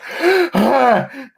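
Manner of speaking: gasp for air. Retold or imitated. Game master acting Pinky thrown through a wall, mock gasp. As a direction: gasp as if winded, hammed up. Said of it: "coughing up air".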